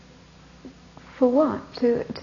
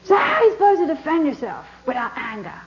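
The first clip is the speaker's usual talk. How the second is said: higher, half-shouted cheeky question; cheeky challenge; 5 semitones higher than usual. Retold or imitated. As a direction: toss the question out to get a laugh. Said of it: "He said".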